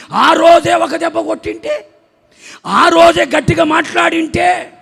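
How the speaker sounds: high, loud preaching cry; impassioned rebuke. Real, not acted. Preacher to lax parents on missed discipline, shouted reproach.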